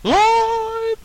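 very high, loud squeal of live; excited shout. Pretend. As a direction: shriek it like a hype man. Echoing the show intro.

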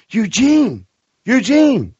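high, drawn-out mock holler; calling out Eugene. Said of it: "Daryl comes up and he's like".

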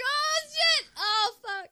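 high, loud squealed swearing; acted shocked reaction. Recalling watching the movie, high loud mock shock. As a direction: cry out in exaggerated guilty shock. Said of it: "I was like".

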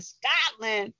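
rising in astonishment; amazed. Retold or imitated. Telling the audience how he reacted to Scotland, amazed.

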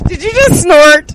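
high, loud disbelieving question; surprised. Real, not acted. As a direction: caught off guard by a snort. Hears a friend snort on mic.

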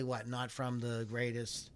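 ordinary talk, nothing marked

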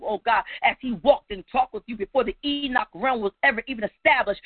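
rhythmic, driving prayer, punctuated with "oh God"; fervent prayer. Real, not acted. Preacher leading the congregation in prayer, fervent and rhythmic. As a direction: pray aloud in steady, urgent phrases.

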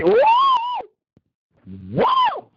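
high, drawn-out whoa; wowed, voice shooting way up; amazed. Real, not acted. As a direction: cry out in high-pitched amazement. Amazed at food spread.

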